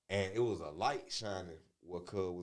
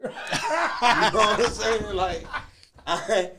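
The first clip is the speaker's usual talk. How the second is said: laughing; 10 semitones higher, 12 dB louder than usual. Real, not acted. Cracking up while telling story.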